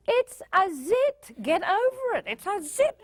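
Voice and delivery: high, emphatic mock exasperation; exasperated parent. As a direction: snap back at a dramatic teen, drawing out the words. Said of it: "look at them and go".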